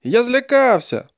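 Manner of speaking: high, slow wail of confession; captive's cry. A narrator's reading. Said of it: "закричав полонений".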